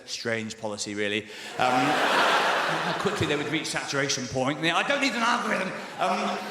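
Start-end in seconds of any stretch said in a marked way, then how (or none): 0.1-1.2 low, understated dad verdict; dad's dry comment. Retold or imitated. Literal-minded dad summing up the song, low deadpan. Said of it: "my dad said".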